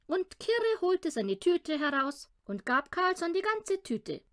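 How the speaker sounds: ordinary delivery, nothing marked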